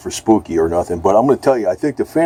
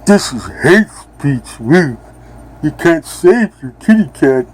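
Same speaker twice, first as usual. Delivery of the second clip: higher, slow, sneering official voice, hammed up; mock censor voice; 6 semitones higher, slower than usual. Mocking imagined censors to his viewers, in a higher, slow, sneering official voice. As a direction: parody a self-righteous censor handing down a ban. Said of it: "the Ministry of Truth will say".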